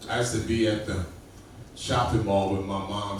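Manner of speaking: ordinary talk, nothing marked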